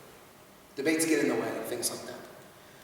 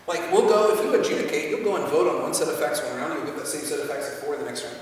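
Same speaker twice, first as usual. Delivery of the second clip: ordinary delivery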